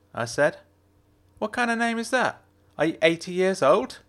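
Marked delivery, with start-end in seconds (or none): 1.4-4.0 Jenna's voice. A narrator's reading. Jenna teasing.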